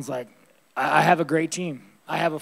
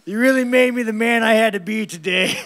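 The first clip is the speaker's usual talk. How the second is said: loud, bitter mock thanks, pitched up; hammed-up thank-you to the man who hurt him; mock gratitude; 4 semitones higher, 6 dB louder than usual. Fighter sarcastically thanks his abusive dad, loud and bitter.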